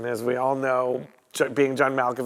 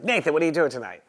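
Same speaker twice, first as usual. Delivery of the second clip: imitating a brash, mouthy agent, quick and loud; aggressive, gruff agent; 4 semitones higher, 5 dB louder, faster than usual. Mouthy agent calls a friend from her hometown, aggressive. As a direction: fire it out like a pushy agent. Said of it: "like, a really aggressive, gruff, mouthy agent".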